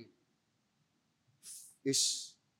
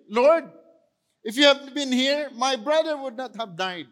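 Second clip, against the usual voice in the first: Mary's anguished plea; 7 semitones higher, 5 dB louder than usual. Retold or imitated. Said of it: "Sinabi rin ni, ni Mary".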